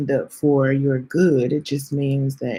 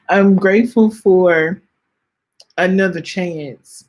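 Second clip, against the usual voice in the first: "I'm grateful for" pushed out louder, voice welling up; near tears; 3 semitones higher, 5 dB louder than usual. Real, not acted. Host thanking for another year, fighting real tears. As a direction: push through the swell of tears. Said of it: "I am about to cry".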